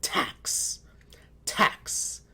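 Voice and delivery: "tax" whispered quick as one word; whispered blend. Shown as a demonstration. Teacher whispers the blended word to students. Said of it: "blend those sounds quickly".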